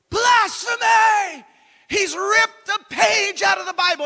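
high, loud, hammed-up cry of outrage; mock-horrified shout, drawn out at the start; scandalized shout. Churchgoer seeing a Bible page ripped, acted scandalized shout. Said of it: "watching somebody's eyebrows go".

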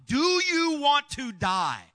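desperate shout, high and loud; pleading yell of a rescuer. Retold or imitated. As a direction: yell it down the drain. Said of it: "I'm yelling at him by this time".

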